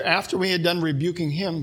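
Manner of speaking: usual speaking voice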